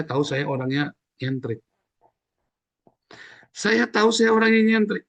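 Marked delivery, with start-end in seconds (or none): 3.5-5.1 much higher and louder, proclaiming it; emphatic repeat. Shown as a demonstration. Preacher proclaiming to the congregation that he is eccentric, loud and high. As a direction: belt out "nyentrik" high and loud. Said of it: "repeat of 141".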